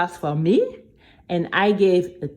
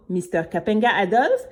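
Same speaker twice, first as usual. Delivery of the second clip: ordinary delivery, nothing marked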